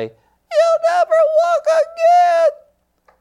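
very high, slow, strained squeak; the menacing voice shrunk to a pathetic squeal; tiny weakened voice. Retold or imitated. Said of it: "I heard this voice say".

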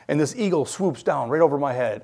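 ordinary talk

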